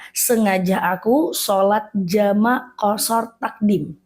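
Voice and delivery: slow, careful recital of the intention; unhurried teaching recitation; reciting the niat. Shown as a demonstration. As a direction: model the words slowly so they can follow. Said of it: "Berarti niatnya adalah".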